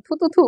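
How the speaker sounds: light "থু, থু, থু" spit sounds, not really spitting; tease-spit three times to ward off the evil eye; jokey spitting noises to guard the dress from jinx; playful mock spitting. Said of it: "এটাতে কয়টা থুতু দিব বলেন?".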